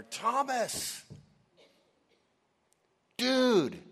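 high, loud excited shout of the name; excited disciples. Acted. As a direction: call out to Thomas, bursting with news. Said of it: "can you see how excited they are".

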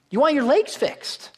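high-pitched, insistent repeat of the obvious; emphatic repeat. Acted. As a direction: lift the whole line high to drive it home.

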